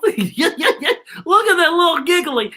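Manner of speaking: high giggly laughing voice; giggling. Real, not acted. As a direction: talk through the giggles. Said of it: "What are you laughing about?".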